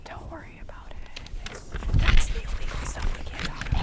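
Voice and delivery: soft ASMR whisper. Pretend. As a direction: hush it like a secret. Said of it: "A S M R".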